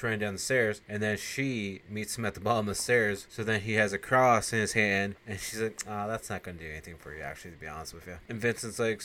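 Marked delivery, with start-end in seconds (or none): 5.9-8.2 soft, fast taunting purr; menacing vampire. Retold or imitated. Regine taunting Vincent about his cross, soft quick purr. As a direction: brush him off quietly.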